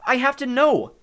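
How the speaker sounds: high, loud and desperate to know; insistent cry. A narrator's reading. Nico begs his sister's ghost for the truth, insisting loudly. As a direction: insist at full voice.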